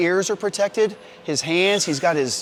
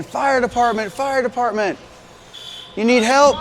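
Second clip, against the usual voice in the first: higher, raised call of a searching firefighter; 7 semitones higher than usual. Shown as a demonstration. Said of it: "He's gonna be saying".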